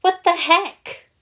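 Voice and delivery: very high, loud, slow disbelief; stunned. A narrator's reading. Garmadon and the ninja gaping after the general, stunned. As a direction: gape at what just happened.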